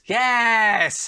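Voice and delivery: gloating celebration. Real, not acted. Host gloating to viewers over his winning pick. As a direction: revel in the win, drawn out then a cheer. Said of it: "I will also revel in my wins".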